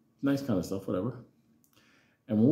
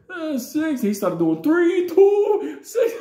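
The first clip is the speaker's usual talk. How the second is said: squealing high, loud numbers; garbled counting; 9 dB louder, much slower than usual. Retold or imitated. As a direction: mimic the boy's brain breaking. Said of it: "weird language".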